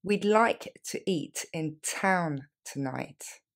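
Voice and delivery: very slow, syllable by syllable. Shown as a demonstration. Teacher to learners, very slow sentence for repeating. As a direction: lay it out slowly for learners. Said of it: "Et on y va plus lentement".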